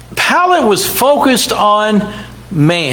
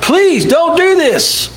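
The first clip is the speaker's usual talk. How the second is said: higher, slow, pleading; wife begging husband, higher and slow; 8 semitones higher, slower than usual. Retold or imitated. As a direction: draw out the plea. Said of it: "And she begged Pilate".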